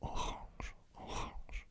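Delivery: orange said the French way, whispered; French pronunciation. Shown as a demonstration. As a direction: orange as a French person would say it. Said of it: "like a French person would".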